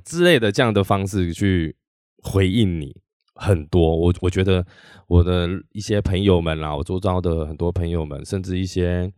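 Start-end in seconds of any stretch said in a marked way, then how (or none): none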